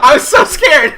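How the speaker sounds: loud, high, laughing confession; real hysterical exasperation; helpless amusement. Real, not acted. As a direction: protest your own blunder while cracking up. Cracking up over own blunder.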